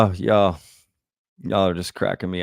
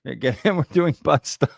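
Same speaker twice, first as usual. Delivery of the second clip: reading the line while giggling; can barely get the words out for laughing; laughing through it; 5 semitones higher than usual. Real, not acted. Reading a joke comment, cracking up.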